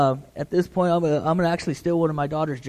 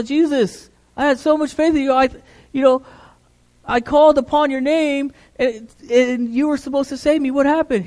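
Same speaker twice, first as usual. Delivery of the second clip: aggrieved complaint; 7 semitones higher than usual. Acted. Drowned man questioning Jesus.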